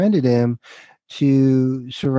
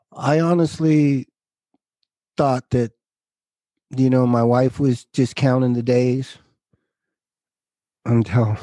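choked up. Real, not acted. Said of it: "I don't wanna get emotional".

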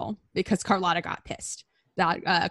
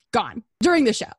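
ordinary delivery

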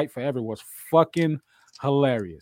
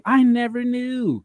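high drawn-out exclamation; 8 semitones higher, slower than usual. Acted. Repeat, high slow far take.

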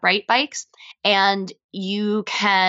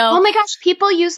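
surprised; 10 semitones higher, 6 dB louder than usual. Real, not acted. Reacting to disbelief.

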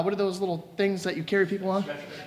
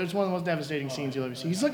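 normal talking voice

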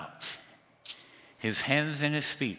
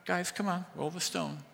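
softer, easygoing plea to the men; gentle coaxing; 6 semitones higher, 6 dB quieter than usual. Shown as a demonstration. Preacher voicing Jesus to the crowd, quiet coaxing. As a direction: coax them, no anger. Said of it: "He's not".

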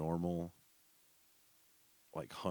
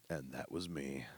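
deadpan whisper; 4 semitones lower, 7 dB quieter than usual. Real, not acted. Punchline after describing job.

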